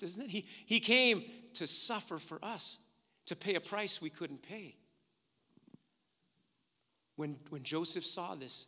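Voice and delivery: ordinary talk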